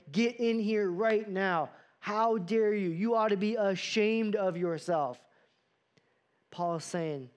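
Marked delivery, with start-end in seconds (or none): 0.1-5.2 a mom scolding her son for shameful behavior, mock angry. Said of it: "Did your parents ever try to shame you".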